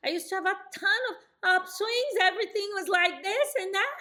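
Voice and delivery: every phrase rising high at the end; sing-song rising tune on each phrase; upswing tone. Shown as a demonstration. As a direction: demo the old habit of upswings. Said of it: "I didn't always speak like this".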